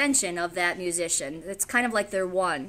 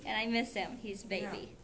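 ordinary delivery

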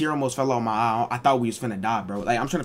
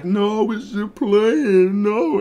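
mock sister; 7 semitones higher, much slower than usual. Sister covering.

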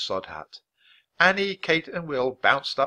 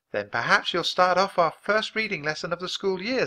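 higher-pitched, kindly teacher voice; encouraging schoolteacher; 9 semitones higher, 4 dB louder than usual. Acted. Teacher opening the first lesson, encouragingly. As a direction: invite the pupil to begin.

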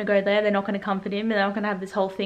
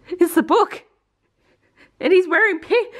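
high, loud excited outburst; excited squeal; 9 semitones higher, 5 dB louder than usual. Real, not acted. Fan reacting to the movie costume, excited and high. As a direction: gush over the pink outfit.

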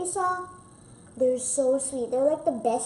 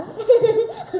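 giggling; 8 semitones higher, 8 dB louder than usual. Real, not acted. Kid laughs.

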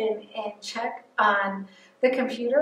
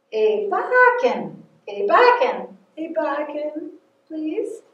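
wet in your voice; 5 semitones higher, slower than usual. Shown as a demonstration. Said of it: "say that with a little bit of wet in your voice".

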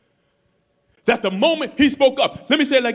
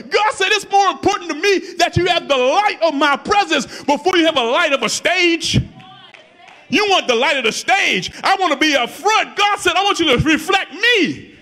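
full-force preaching; 7 semitones higher than usual. Acted. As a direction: lift it up and shout it to the back pews.

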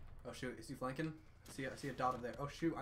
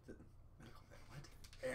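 whispered; 13 dB quieter than usual. Real, not acted. Joking aside.

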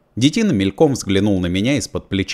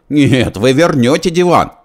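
loud, higher shout; 7 semitones higher, 6 dB louder than usual. A narrator's reading. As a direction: shout after him. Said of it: "с криком". (громкий крик)

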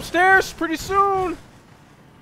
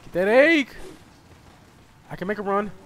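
ordinary talk, nothing marked

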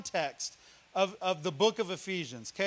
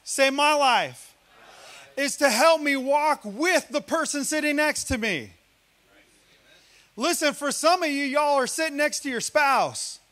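preaching; 4 semitones higher, 4 dB louder than usual. Real, not acted. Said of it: "I'm preaching hard".

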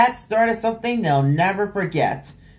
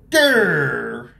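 mock growl; 5 semitones lower than usual. Hen got mad.

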